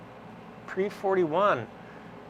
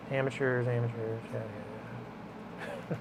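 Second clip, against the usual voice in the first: softly muttered, dismissive comic impression; Tim Heidecker; 3 semitones lower, 8 dB quieter, slower than usual. Host scoffing at amateurs in an impression, dismissive mutter. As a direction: lower and quieter, trailing into dismissive yeahs. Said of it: "That was my Tim Heidecker impression".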